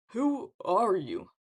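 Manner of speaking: slow, drawn-out haughty question; slow, scornful challenge; scowling disdain. A narrator's reading. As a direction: stretch each word with disdain. Narcissus scowls at Leo.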